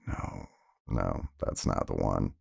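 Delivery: low, soft reply; subdued denial. Retold or imitated. Retold deflated answer to mistaken identity.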